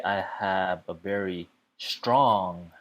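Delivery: "strong" drawn out slowly for emphasis; stress on strong. Shown as a demonstration. Teacher showing students emphasis when speaking, "strong" stressed and stretched. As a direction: lean long and slow on "strong". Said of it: "put more emphasis in the strong word".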